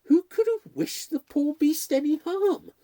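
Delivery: high, loud lament over the poor cat; dismayed. A narrator's reading. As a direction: wail the question in dismay. Butler dismayed at cat's killing.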